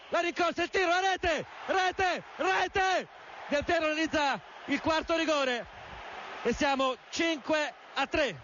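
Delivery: high shouted goal call; excited goal call. Real, not acted. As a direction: roar the goal, rising. Del Piero scores penalty.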